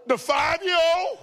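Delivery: gasp, then high, loud question; mock-scandalized, pitch shooting up; shocked disbelief. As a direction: repeat it back in comic astonishment. Shocked at child cussing.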